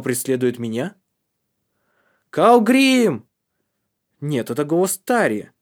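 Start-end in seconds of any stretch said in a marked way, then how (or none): 2.3-3.3 a high, loud shout from a distance; ringing yell of a name; calling out. Acted. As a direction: call his name down the slope. Tari calls out from above.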